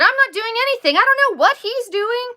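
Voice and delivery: high, loud defensive outburst; heated protest. Retold or imitated. Stepmom answers husband during tantrum, heated protest.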